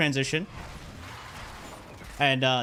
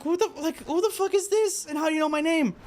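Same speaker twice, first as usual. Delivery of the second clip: incredulous; 13 semitones higher, faster than usual. Retold or imitated. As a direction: shriek it at the mystery caller. Confronting unknown caller.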